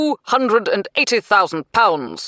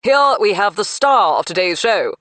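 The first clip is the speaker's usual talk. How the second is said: auctioneer announcing; 5 semitones higher, 9 dB louder than usual. A narrator's reading. Auctioneer presents star car.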